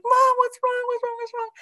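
put-on high, anxious little kid asking; worried child.

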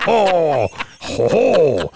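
loud, drawn-out "oh" laughter; French-guy laugh. Real, not acted. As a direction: laugh in long French-sounding ohs. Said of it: "laugh like a French guy".

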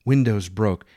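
plain reading voice

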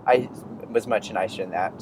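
usual speaking voice

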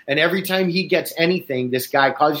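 ordinary talk

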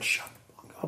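quoted under the breath; breathy whisper of the heckle. Retold or imitated. Heckler during daughter song, whispered quote.

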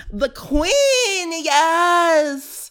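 hammed-up fan shriek for the queen; mock fangirl squeal. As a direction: squeal it like a starstruck superfan. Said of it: "I'm sorry. I had to".

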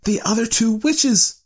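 high-pitched, excited insistence; urgent reply. A narrator's reading. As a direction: press the idea on your husband urgently. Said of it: "she replied rapidly".

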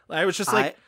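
ordinary talk, nothing marked

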